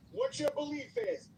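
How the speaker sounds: ordinary talk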